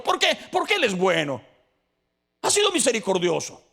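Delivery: high, loud fervent preaching; rally the congregation, voice lifted; preaching at full force. Acted. Preacher rallying the congregation, high and loud fervent call.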